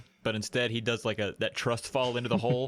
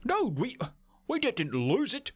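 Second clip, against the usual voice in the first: higher, mock Viking islander; a hammed-up Viking accent, higher voice; weird Viking accent; 10 semitones higher than usual. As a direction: play an annoyed Polynesian in a strange Viking accent. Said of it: "What is this accent that I'm doing".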